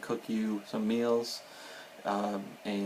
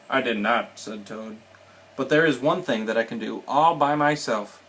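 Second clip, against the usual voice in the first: animated storybook reading, louder for the dialogue; reading to children; 5 dB louder than usual. A narrator's reading. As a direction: read it lively, lifting the characters' lines.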